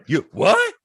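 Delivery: stammering, high-pitched amazement; retold astonishment. Retelling first meeting with Tom Holland, astonished.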